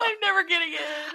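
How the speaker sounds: high whine through laughter; laughing wail. Real, not acted. Sure she ruined her college interview, laughing lament. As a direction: lament it while cracking up. Mortified, laughing at own story.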